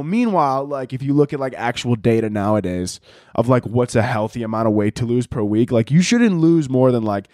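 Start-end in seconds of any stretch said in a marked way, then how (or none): none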